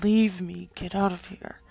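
loud, forceful order to leave; crying out. A narrator's reading. Fallen angel to Jesus, crying out to be left. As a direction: cry it out, driving him away. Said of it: "He cried out".

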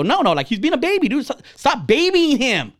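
high-pitched, insistent retold objection; exasperated protest. Dad arguing with mom over coddling son, exasperated. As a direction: push back hard, stretching the words. Acted argument with son's mom.

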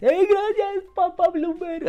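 high, loud, slow, goofy caricature; mocking Gatell. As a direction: play him as a smug idiot. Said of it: "el Gatell".

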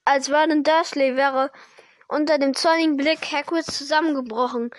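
ordinary delivery

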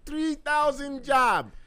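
bad Jamaican accent, slow and high; hammy Jamaican impression. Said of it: "that's the worst Jamaican accent in history".

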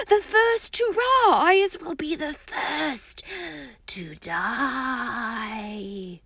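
slow hushed seer's doom; ominous mystic warning. A narrator's reading. Seer warning the table of death, hushed dread. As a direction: draw it out, dreading fate. Trelawney's dramatic foreboding.

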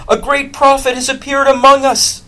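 high, loud proclamation; crowd crying out. Acted. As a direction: call it out to the whole crowd. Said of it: "they cried".

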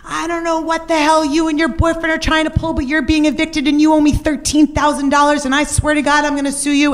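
high, mock screaming landlord. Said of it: "he's screaming".

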